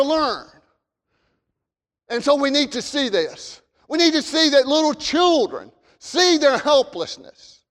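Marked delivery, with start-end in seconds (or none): none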